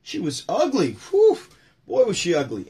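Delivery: high, loud punchlines with a big whew; hammed-up comic exasperation; Rodney Dangerfield bit. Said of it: "And then he goes".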